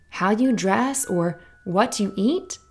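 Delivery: plain reading voice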